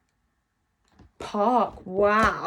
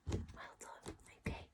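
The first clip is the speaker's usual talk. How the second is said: whisper; 23 dB quieter than usual. Shown as a demonstration. Whispered praise to class.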